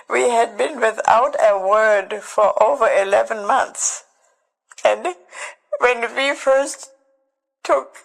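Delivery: words barely held together through tears; voice breaking. Real, not acted. Telling of finding her mother alive, voice breaking.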